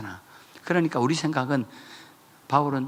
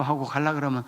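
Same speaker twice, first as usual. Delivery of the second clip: usual speaking voice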